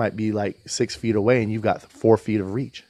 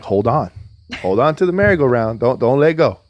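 calling out to daughter; 3 semitones higher, 6 dB louder than usual. Retold or imitated. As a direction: call over to a child on a spinning ride. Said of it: "and I'm like".

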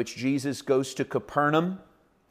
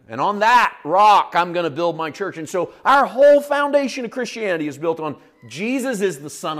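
preaching higher and louder, driving the point home; rising sermon fervour; 5 semitones higher, 3 dB louder than usual. Acted. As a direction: build it like a pulpit climax. Preacher builds to full force.

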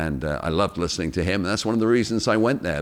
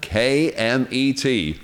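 normal talking voice

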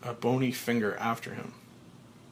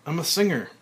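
joyful declaration; 5 semitones higher, 5 dB louder, faster than usual. Acted.

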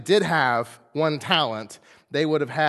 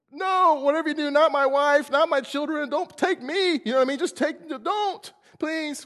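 pleading; 13 semitones higher, 4 dB louder than usual. Acted. Servant begs not to take family.

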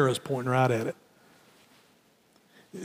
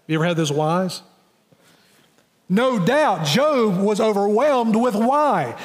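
normal talking voice

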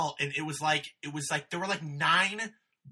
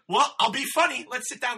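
friendly Maria; 6 semitones higher, 7 dB louder than usual. Retold or imitated. Maria imitated, higher.

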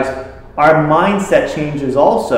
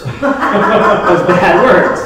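laughing; 3 semitones higher, 4 dB louder, much slower than usual. Real, not acted. Laughing at own story.

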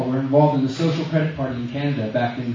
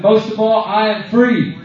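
loud affirmation for the crowd to repeat; booming motivational speech; 6 semitones higher, 6 dB louder, slower than usual. Real, not acted. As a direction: proclaim it for the room. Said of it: "please repeat after me".

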